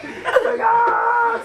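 high loud mock cub scream; hammed-up wail, laughing through it; baby scream. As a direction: scream like the bawling baby bear. Baby bear crying even more.

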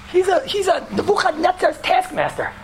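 high-pitched comic complaint; mock outrage. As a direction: protest about the coach in a high, half-laughing voice. Protesting the painful exercises.

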